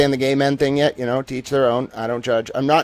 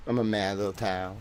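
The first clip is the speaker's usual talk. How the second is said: quiet self-mockery; 5 semitones lower, 9 dB quieter than usual. Self-mocking quiet aside.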